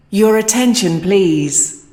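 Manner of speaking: normal talking voice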